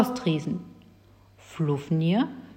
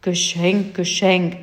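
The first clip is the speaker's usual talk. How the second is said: grumbled; 4 dB louder, slower than usual. Acted. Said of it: "murrte der Riese".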